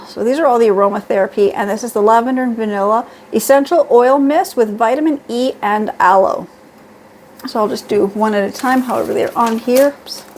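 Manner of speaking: ordinary delivery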